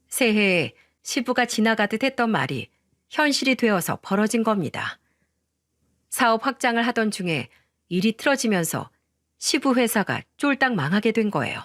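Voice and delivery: ordinary delivery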